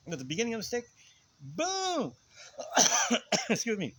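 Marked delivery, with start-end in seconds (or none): 1.4-2.1 long, high-pitched "boom" for a blow landing; booming hit. Retold or imitated. Storyteller acting out a stick fight, booming hit. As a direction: draw out the impact sound high.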